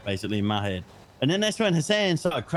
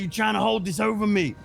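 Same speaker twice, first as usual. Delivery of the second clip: raised, mock-accusing voice for the angry Hassan; imitating Hassan; 8 semitones higher than usual. Retelling how Hassan called him out, imitating Hassan. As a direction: play Hassan catching you out, pitched up. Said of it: "He's like".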